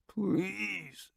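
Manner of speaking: soft, faint plea; a quiet weak beg; begging. A narrator's reading. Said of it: "he begs".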